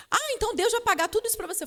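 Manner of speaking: high, quick mocking question; imitated mockery. Boss scoffing at the employee's faith in God paying, retold mockery. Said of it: "Aí começou o deboche".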